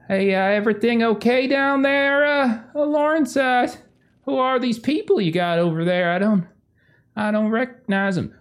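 high-pitched, hesitant folksy call; wary slender woman Tex. Acted. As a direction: play a puzzled woman checking on strangers. Game master plays Tex peeking out.

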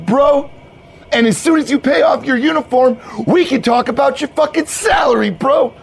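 high, hollered bro-speak; hyped-up bro, pumped. Acted. Manager explains night shift and salary, pumped bro. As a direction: lay out the job like a pep rally.

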